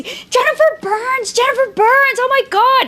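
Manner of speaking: high-pitched imitation of a frantic fan; mock fan freak-out, way up high; crazed fan. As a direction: squeal the name like a starstruck girl going crazy. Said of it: "this one girl just went crazy".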